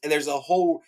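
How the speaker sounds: high-pitched, worked-up rant; fired up. Real, not acted. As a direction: plead your grievance as an outraged book fan. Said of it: "I'm really fired up about this".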